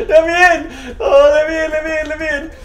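high, loud hammed-up begging; mock pleading. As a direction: whine to be let in, playfully. Begging to get through gate.

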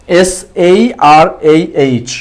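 slow, letter by letter; spelling out. Shown as a demonstration. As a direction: spell the name for writing down. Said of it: "ওনার নামটা একটু লিখে দিই".

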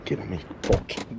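low unvoiced mutter; muttering under breath. Real, not acted. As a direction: whisper it to yourself. Repeats line differently.

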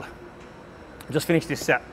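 panting quietly between breaths, slow. Real, not acted. Lifter to camera right after his set, breathless and soft. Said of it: "I'm a little bit out of breath right now".

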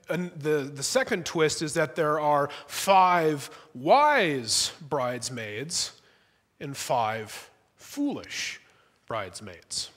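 ordinary delivery

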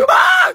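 sudden high loud scream; sharp yelp of shock; startled scream. Real, not acted. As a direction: shriek in surprise. Sudden fright at the pet.

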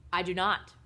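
loud, firm fatherly refusal; emphatic, unamused no. Acted. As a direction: shut the offer down loudly. Father flatly refuses son's offer.

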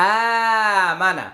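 আ held long, then short মানা; one-alif stretch. Shown as a demonstration. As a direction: draw out আ before a quick মানা. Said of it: "আ হচ্ছে এক আলিফ টান".

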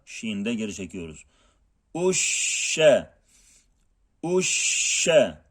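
"Eşşe" with a spreading, held sh; spreading shin. Shown as a demonstration. Teacher drills the spreading shin, held and drawn out. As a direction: let the "şş" spread long. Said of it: "Tefeşşi sıfatı var".

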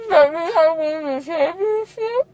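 tearful, pleading and loud; crying. Real, not acted. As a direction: sob out the plea. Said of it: "No crying".

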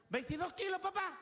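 a hint of a proud little girl; little daughter voice. Daughter reads the scale.

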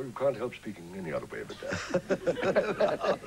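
slow, stiff-jawed speech; fixed-jaw voice. Shown as a demonstration. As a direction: speak as if your jaw won't move. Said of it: "if you have a permanently fixed jaw".

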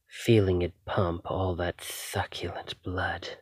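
soft, hungry whisper; intoxicated whisper. Acted. Vampire feeling her captive's heartbeat, hungry whisper. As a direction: murmur as if drunk on it.

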